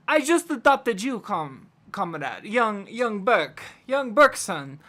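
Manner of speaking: put-on loud, halting Russian-accented father. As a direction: play it up as a heavy Russian comrade. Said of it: "I gotta switch to Russian".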